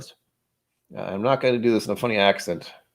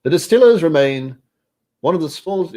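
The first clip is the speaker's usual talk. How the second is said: a mock English accent, pitched higher and louder; 8 semitones higher, 5 dB louder than usual. Streamer slides from Scottish into a playful English accent. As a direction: drift into a hammed-up English accent mid-read. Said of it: "I'm going English".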